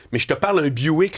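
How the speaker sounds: normal talking voice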